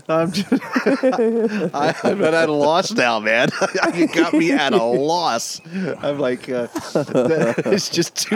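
high-pitched giggling talk; breathless laughter; laughing. Real, not acted. Laughing fit.

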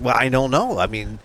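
ordinary talk

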